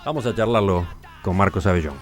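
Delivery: scripted presenter voice with emphatic key words; radio intro read. Acted. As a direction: announce the topic with deliberate pauses and lively pitch swings. (locución de presentación radiofónica)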